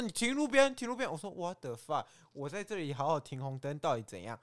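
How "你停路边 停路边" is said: high, loud command to pull over; stern police order. Retold or imitated. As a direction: bark it like a traffic cop. Police officer ordering her over. (警察命令)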